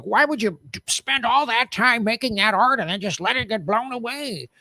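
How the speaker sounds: a light mock of a puzzled American; imitating an American. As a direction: play the baffled American, lightly. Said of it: "an American would be like".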